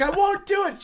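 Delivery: very high, shrill refusal; hammed-up hysterical woman; mock shrieking cashier. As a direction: squeal it like a panicked clerk.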